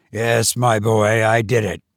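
a hint of a tired old man pleading. A narrator's reading. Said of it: "as a very old man could plead".

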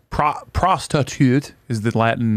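a hint of German accent, lightly voiced; clipped mock German professor reading Latin; joking about Latin roots, a light mock German accent. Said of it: "that was a German accent".

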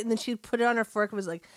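ordinary talk, nothing marked

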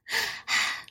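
put-on soft, voiceless baby breaths; quiet breathy puffs, mimicking a happy baby; imitated breathy baby noise. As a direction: imitate a baby's breathy panting. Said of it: "he does this weird breathy thing".

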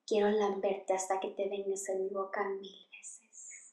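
very soft, low murmur into a lover's ear; imitated seductive whisper. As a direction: whisper it in Spanish, imitating an intimate moment. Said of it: "Carmen whispers in, he-, in, on, in Shane's ear".

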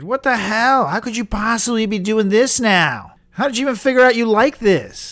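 high, loud disbelief; appalled outburst. Acted. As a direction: demand an explanation, aghast. Appalled host scolds misbehaving guest.

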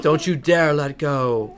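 slow, drawn-out film quote; dramatic plea. Retold or imitated. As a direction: plead with each word stretched.

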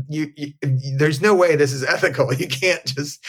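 high, breathless disbelief with a laugh; panicked pleading. Retold or imitated. Still panicking, then laughs.

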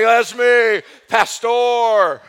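loud, high, showy "That's me, Pastor"; hammed-up excitement. Pastor imagines himself on a police TV show, loud and hammed-up. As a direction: boast it like a star on a TV cop show. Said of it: "I would have been like".

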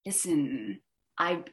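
low slithery Clawdite threat; hushed bounty hunter menace, lightly done; lightly voiced bounty hunter. Shown as a demonstration. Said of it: "just sort of".

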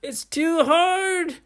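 high, strained groan rising into a cry; slow, hammed-up squeal of strain; being squeezed. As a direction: force it out as if crushed by a vine. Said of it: "squeezing Brady".

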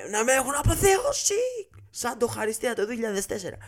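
high, carried-away boast; euphoric bragging. Real, not acted. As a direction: crow about being treated like a hero. Euphoric bragging about being cheered.

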